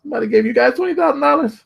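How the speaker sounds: hammed-up astonishment at the money; disbelief. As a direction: shriek it in amazed disbelief. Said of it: "I was like".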